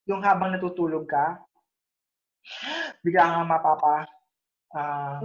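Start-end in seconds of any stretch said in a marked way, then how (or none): 2.5-3.0 sharp, high in-breath gasp like waking up choking; sudden gasp. Shown as a demonstration. Teacher demonstrates a sleep apnea gasp for students, a sharp gasp. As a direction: suck in air fast as if breathing just stopped. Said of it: "hugot ng hininga kasi tumigil yung paghinga mo".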